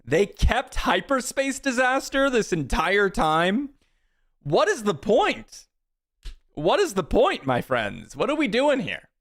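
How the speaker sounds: high, drawn-out incredulous complaint; what is even the point, pleading with the game; exasperated disbelief. Real, not acted. Opponent stalling with a useless card.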